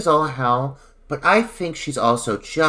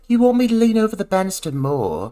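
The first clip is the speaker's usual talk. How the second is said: higher, ditzy questioning voice; higher ditzy voice, the actress questioning the director; mock Trish; 6 semitones higher, faster than usual. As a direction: play her as a ditzy starlet. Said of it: "she's like".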